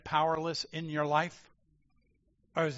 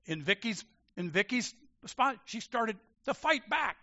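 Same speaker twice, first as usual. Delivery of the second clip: ordinary talk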